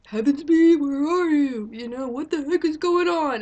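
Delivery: loud, high angry yell of a leader; mock furious president shouting; angry President Snow. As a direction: bark for your missing man. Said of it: "and he's all like".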